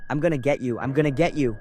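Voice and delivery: menacing yell. Acted. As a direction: yell it like a chasing demon. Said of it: "he kept yelling behind me".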